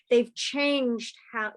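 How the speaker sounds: ordinary talk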